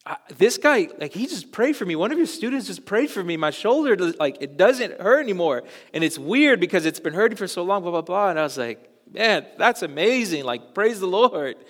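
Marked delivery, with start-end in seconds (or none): 0.1-8.3 imitating an amazed man, high and loud; high-pitched, loud retold amazement; freaking out. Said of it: "he's, like, freaking out". 9.1-11.6 high, louder retold amazement; raised-voice re-enacted wonder; amazed praise. Acting own amazed reply to pastor.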